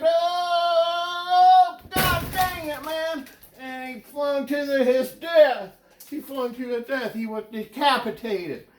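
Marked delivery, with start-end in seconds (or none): 0.0-3.3 much higher, sing-song yelp of dismay; Steuben's panicked cry. Retold or imitated. Steuben cursing as the ride spins too fast, high sing-song yelp. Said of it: "flinging around like". 3.6-5.7 louder, higher mock-tragic cry on his death; dramatic outburst. Comedian hamming Steuben's fatal fling, louder mock-tragic cry.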